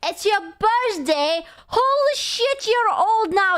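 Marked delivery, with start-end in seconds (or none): 1.7-3.6 surprised. Real, not acted. Birthday surprise.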